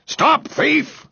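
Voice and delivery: high-pitched alarmed bellow. A narrator's reading. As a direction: cry out after a thief. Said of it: "bellowed Mister Grumby".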